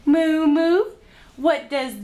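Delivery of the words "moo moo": mooing loud and high like a cow; playful cow moo. Pretend. Imitates cow for toddler.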